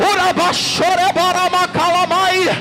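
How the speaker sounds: speaking in tongues. Real, not acted. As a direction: drive out rhythmic syllables in a high, forceful stream.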